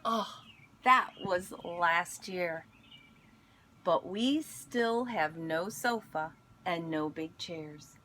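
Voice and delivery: gentle storybook read-aloud; picture book reading voice; reading to children. Acted. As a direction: read it like story time for kids.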